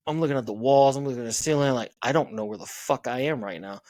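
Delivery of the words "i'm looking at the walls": ordinary delivery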